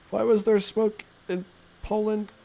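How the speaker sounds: high, slow mock-innocent voice; high silly put-on question; weird voice for chat. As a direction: ask it in a goofy put-on voice. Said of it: "read your voices aloud in a very weird way".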